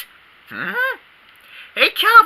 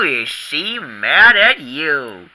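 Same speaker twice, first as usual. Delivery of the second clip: usual speaking voice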